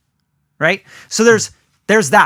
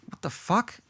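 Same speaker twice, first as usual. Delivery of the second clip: much softer, stunned disbelief; stunned viewer; 3 semitones higher, 13 dB quieter than usual. Retold or imitated. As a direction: breathe it out in shock. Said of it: "I could say".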